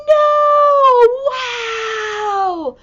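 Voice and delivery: high, loud astonished cry. Acted. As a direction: burst out in amazement. Amazed at the hat's contents.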